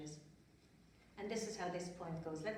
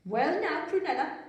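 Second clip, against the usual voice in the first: a lightly voiced mother, higher and louder; gentle scolding mother, raised; mother's voice; 7 semitones higher, 10 dB louder than usual. A narrator's reading. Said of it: "said her mother".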